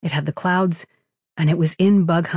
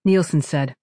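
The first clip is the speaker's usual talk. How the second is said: plain reading voice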